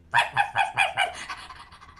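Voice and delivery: rapid breathy dog woofs; dog panting and barking. Acted. Storyteller plays the dog arriving, panting woofs. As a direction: pant and yap like a dog.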